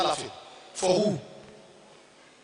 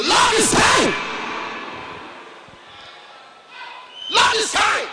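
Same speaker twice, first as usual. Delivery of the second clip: preaching shout; 10 semitones higher, slower than usual. Acted. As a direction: cry it out to the rafters.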